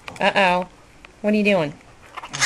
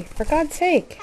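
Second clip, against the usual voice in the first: normal talking voice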